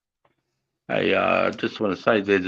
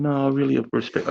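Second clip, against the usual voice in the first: female lieutenant; 8 semitones higher than usual. Acted. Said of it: "And she's like".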